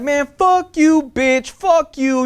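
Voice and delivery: high, slow, drawn-out mock cursing; imitated defiant cursing.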